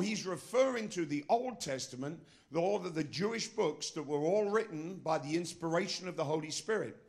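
ordinary talk

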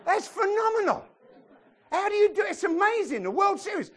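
loud, high-pitched exaggerated marvelling; mock amazement. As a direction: gush at full volume, voice shooting up. British comedian mocking the World Series.

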